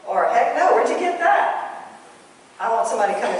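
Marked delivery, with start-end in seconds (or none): 0.2-1.8 high, drawn-out astonished denial; indignant denial. Acted. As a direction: deny it in high-pitched disbelief. Acted denial of an accusation.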